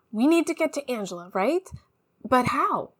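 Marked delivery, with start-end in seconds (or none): none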